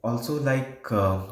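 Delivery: ordinary delivery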